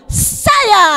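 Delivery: put-on high child-like answer; answering saya. Shown as a demonstration. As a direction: answer like an eager kid. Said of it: "Badiri kau bilang". (jawab seperti anak-anak)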